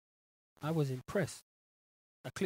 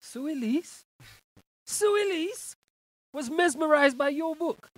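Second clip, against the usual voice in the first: high, loud dramatic reading; theatrical reading; 11 semitones higher, 9 dB louder, slower than usual. Acted. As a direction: read it out like a grand announcement. Host reads fan comment theatrically.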